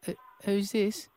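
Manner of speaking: put-on high, small, hesitant child voice; little English boy. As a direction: pretend to be a timid little boy on the phone. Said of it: "He's a four-year-old little guy".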